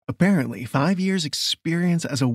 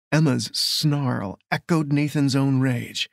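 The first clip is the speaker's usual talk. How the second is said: plain reading voice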